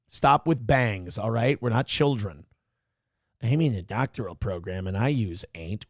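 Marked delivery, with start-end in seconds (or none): 3.4-5.8 low, soft mock quote; mocking imitation. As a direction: parrot her boast in a lower, quieter voice. Mimics the woman from the clip.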